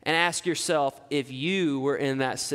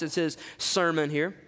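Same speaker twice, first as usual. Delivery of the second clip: normal talking voice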